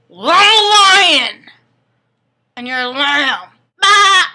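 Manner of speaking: slow, loud roar ending in a high bleat; hammed-up roar. Vampire calls himself lion and her lamb, hammed-up roar and bleat. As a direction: ham it up like a roaring beast.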